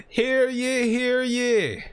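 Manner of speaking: high, ringing town crier call; mock royal decree. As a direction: proclaim it like a herald. Announcing his speech to crowd.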